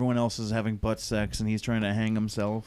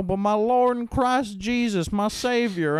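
mock pious plea; 8 semitones higher, 3 dB louder than usual. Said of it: "then I go".

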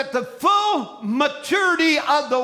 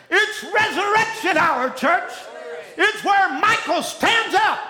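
high, driving revival-preacher shout; preaching at full force; 6 semitones higher, 3 dB louder than usual. Real, not acted. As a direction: preach it loud and urgent, hammering each phrase.

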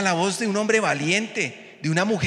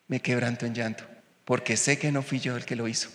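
tender and soft; 5 semitones lower, 5 dB quieter than usual. Real, not acted. Testimony of healing.

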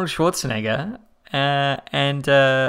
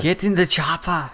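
put-on slow, heavy action-hero accent; Arnold impression; 3 semitones higher than usual. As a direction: drag it out like a movie tough guy. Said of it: "almost as good as my Australian accent".

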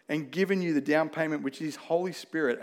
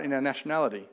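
ordinary delivery

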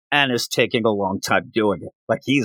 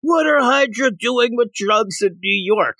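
retold yelling; 9 semitones higher than usual. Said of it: "starts yelling".

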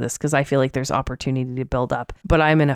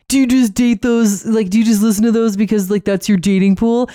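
brother voice; 6 semitones higher, 5 dB louder than usual. Retold or imitated. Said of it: "he'd be like".

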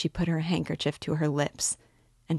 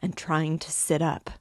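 ordinary delivery, nothing marked

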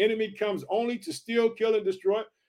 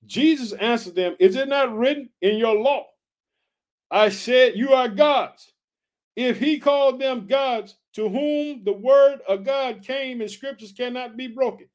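slow, raised preacher reading; scripture proclamation; 4 semitones higher, 4 dB louder, slower than usual. Acted. Preacher reading Jesus' answer from the law, forceful scripture proclamation. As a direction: ring out each phrase of the verse.

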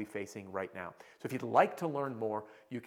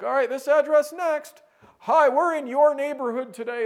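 high, loud generic pitch; clueless canvasser on autopilot; robotic door knocker; 14 semitones higher, 13 dB louder than usual. Retold or imitated. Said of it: "walking around the clipboard like".

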